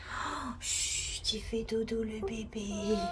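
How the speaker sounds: soft hushed whisper. Real, not acted. Hushing, animal is sleeping.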